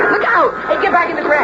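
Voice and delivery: alarmed call pitched high; urgent warning shout. Acted. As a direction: yell at her to get clear. Warning sister of stampede.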